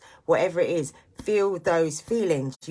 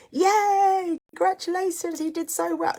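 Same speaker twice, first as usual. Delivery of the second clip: much higher, gushing proud-mum cheer; mock proud mum; 4 dB louder than usual. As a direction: squeal it like a bragging parent online. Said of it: "puts up a picture of my kid saying".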